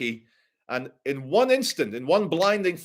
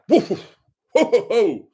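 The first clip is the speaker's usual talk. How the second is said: higher, louder repeated whoa; mock alarm; 6 semitones higher, 5 dB louder, much slower than usual. Reacting to attack.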